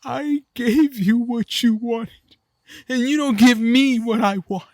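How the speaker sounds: put-on high, slow, whimpering child's plea; crying kid. The kid crying to his dad, slow tearful whine. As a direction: whine through tears like a hurt kid. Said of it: "He's all crying".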